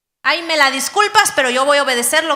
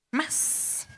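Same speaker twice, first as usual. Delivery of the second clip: whispered, much softer "más"; susurro; 16 dB quieter than usual. Acted. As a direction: breathe the word "más" out as a secret. Predicador remata la promesa. ("más" susurrado, mucho más suave)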